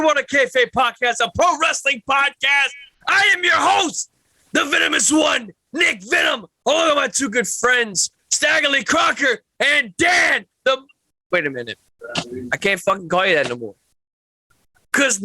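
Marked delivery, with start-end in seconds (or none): 0.0-10.8 ring-announcer style introduction, a touch raised; punchy wrestling-show host read; announcer intro. Acted. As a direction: announce yourself like the show's opening act.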